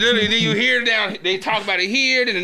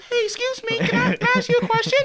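much higher, sweet and polite imitation of a coworker. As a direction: put on a prim, high polite voice to ask. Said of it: "She kind of like".

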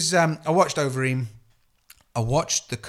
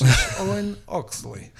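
slow, overly careful Owen Oxley, pitched up; recording pronunciation; 6 semitones higher, slower than usual. Shown as a demonstration. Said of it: "I have to say it like this".

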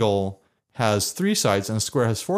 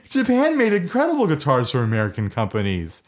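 normal talking voice

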